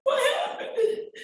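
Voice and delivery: sobbing, very high-pitched wail through the words; real crying. Real, not acted. Mother recalls screaming at news of her son's death, sobbing. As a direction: break down in tears, voice soaring and stretching the words.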